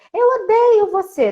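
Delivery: put-on high, loud, slow child yelling; child's tantrum. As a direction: shout it like an angry little kid. Child shouting at parent.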